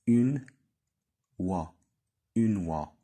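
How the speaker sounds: Une oie with oie said as wa, une stressed; teacher demo of oi. Shown as a demonstration. Teacher to pupils, modelling oie as wa. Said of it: "وهنا كيتنطق".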